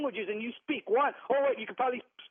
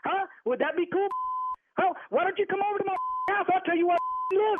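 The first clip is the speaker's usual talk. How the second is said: high, fast, heated dare; daring the caller to come over; angry challenge; 7 semitones higher than usual. Real, not acted. Victim dares prank caller to visit, heated.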